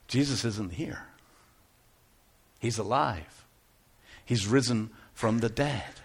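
low, soft retelling of an angel's words; quiet, measured quote; hushed announcement. Retold or imitated. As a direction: deliver the news gently, with pauses. Angel's news retold in hushed awe.

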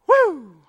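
loud, high joyful "woo"; excited whoop. Real, not acted. Preacher imagining what heaven is like, whooping with excitement. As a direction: let out an eager whoop.

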